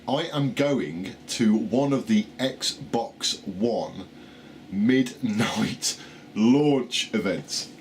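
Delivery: very slow, every word placed deliberately; slow it right down and get it out; careful slow retry. Real, not acted. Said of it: "Right, try again".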